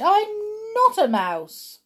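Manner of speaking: high, loud and slow denial; crying out. A narrator's reading. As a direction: stretch it out in protest. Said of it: "he cried".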